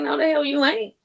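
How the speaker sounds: high, sharp defiant comeback; indignant high snap; defiant retort. Retold or imitated. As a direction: throw it back at him, refusing. Defying his threat.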